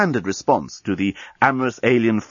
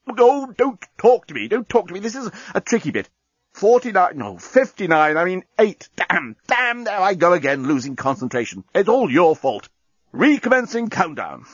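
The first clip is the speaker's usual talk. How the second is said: high, fussy bomb losing count; flustered talking bomb; 8 semitones higher than usual. Acted.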